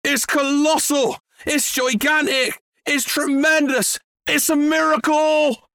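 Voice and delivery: high-pitched, marvelling exclamations; overjoyed farmer, stretching his superlatives; excited shout. A narrator's reading. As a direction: relish each word with rising wonder. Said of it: "Mister Cranky shouted, dancing round".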